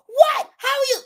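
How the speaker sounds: very high, loud mock disbelief; shocked. Buyer hears the deposit is lost, retold high shock. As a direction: act out a squawk of outrage.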